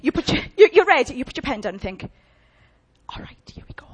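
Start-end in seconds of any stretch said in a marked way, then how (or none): 3.1-3.9 hushed aside to yourself; whisper. Retold or imitated. Practitioner bracing inwardly, whispered aside. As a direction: breathe it like a private sigh.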